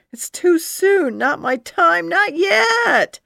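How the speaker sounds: high, loud, desperate pleading; desperate fear. Acted. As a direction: cry out in panic as the wall nears. About to hit the wall.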